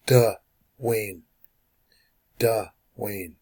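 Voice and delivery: "Duh", a clear pause, then "Wayne"; syllable break. Shown as a demonstration. Teacher showing where the syllable breaks, "Duh" and "Wayne" separated. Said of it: "The syllable is gonna break here".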